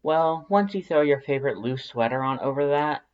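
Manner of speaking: usual speaking voice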